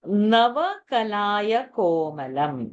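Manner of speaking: Navakanaya komalam drawn out slowly; slow word-by-word. Shown as a demonstration. Teacher explains the verse to student, slow drawn-out quoting. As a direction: stretch each word of the verse.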